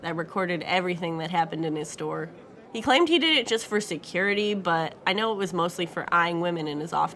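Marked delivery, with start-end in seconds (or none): none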